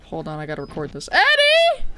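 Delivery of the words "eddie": very high, loud shriek; mock anguished scream. Player calling for dead Eddie, mock anguished scream. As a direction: scream the name in hammed-up horror. Screams his name again to record.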